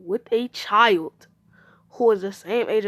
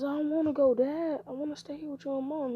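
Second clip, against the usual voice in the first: put-on higher-pitched child's protest; lightly acted pleading to stay; pleading refusal; 6 semitones higher, 3 dB quieter than usual. Son begs Dad not to send him away, pleading.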